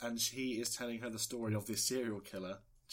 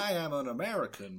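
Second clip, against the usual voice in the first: put-on Doctor Strange accent; 2 semitones higher than usual. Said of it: "A Doctor Strange accent".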